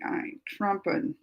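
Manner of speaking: usual speaking voice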